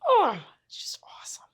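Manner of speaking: breathy hushed whisper of delight; whispered awe over a perfect sleeve; awed whisper. Real, not acted. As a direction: whisper it like a secret thrill.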